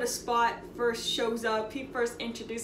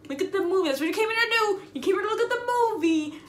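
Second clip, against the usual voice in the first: 1 annoyed scolding; 6 semitones higher, 3 dB louder, faster than usual. Retold or imitated. Scolding woman in theater.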